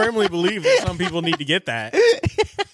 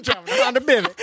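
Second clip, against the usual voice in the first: laughing. Acted. Joking imitation, laughing.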